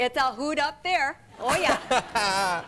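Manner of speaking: loud, slow Minnesota accent; Minnesota-Dakota accent. Shown as a demonstration. Guest jokes about back home, Minnesota-Dakota accent. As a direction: ham up the folksy Dakota accent. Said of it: "that you have that accent".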